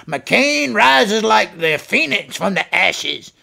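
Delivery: slow, grand announcer voice; mock grand announcement. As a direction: announce it like a film trailer, slowly.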